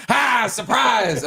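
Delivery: high, drawn-out mock surprise yell; stretched, high-pitched "surprise" call; surprise shout. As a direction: imitate a friend jumping in to startle. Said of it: "He scared the shit out of me".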